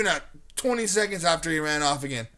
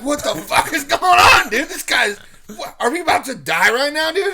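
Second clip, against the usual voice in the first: loud, high, retold panic; alarmed yelling; 11 semitones higher, 10 dB louder than usual. Frightened teens reacting to the screaming stranger, alarmed. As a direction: exclaim it in loud disbelief, acting out the scare.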